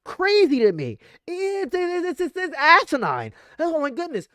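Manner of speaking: high, stammering disbelief; flabbergasted. Real, not acted.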